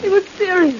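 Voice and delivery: high, frightened gasped-out account; terrified. Acted. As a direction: tell it while still in a panic.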